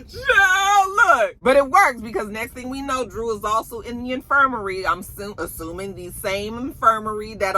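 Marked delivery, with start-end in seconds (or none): 0.1-1.4 laughing exclamation. Real, not acted. Cracking up at the scene.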